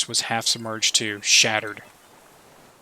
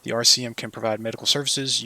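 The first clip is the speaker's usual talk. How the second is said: ordinary talk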